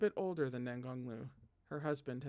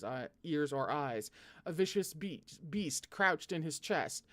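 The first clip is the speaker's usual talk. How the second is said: ordinary delivery, nothing marked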